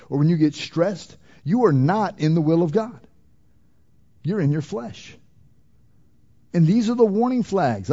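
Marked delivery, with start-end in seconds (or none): none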